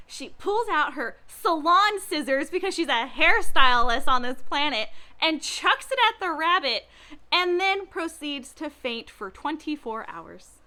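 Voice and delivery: normal talking voice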